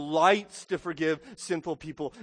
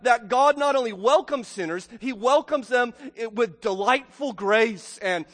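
fervent pulpit voice, louder and punched; preaching at full force; 6 semitones higher, 7 dB louder than usual. Acted. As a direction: hammer home each bad people.

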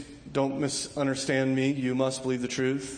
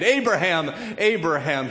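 calling the name louder, slightly higher; urgent call; 4 semitones higher, 6 dB louder than usual. Retold or imitated. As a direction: call out to stay his hand. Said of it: "he calls".

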